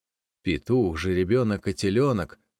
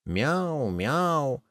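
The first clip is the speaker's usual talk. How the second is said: high cat meow; cat meows over its food; 6 semitones higher than usual. Acted. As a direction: mew like a cat eating. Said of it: "Ест и мяукает".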